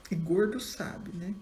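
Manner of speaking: usual speaking voice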